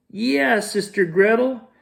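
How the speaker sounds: high put-on child voice; disguised Hansel. Disguised wolf answers sister in high put-on voice. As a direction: play the lost brother sweetly.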